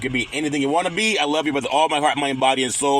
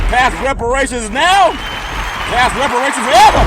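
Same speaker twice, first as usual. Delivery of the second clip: loud rally chant; 10 semitones higher, 6 dB louder than usual. Shown as a demonstration. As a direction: belt it out for the crowd. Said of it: "say it loud".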